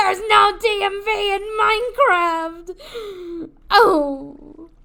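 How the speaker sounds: very loud, high mock-despair cry; anguished wail. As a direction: wail it out in hammed-up agony. Mock despair at failed rescue.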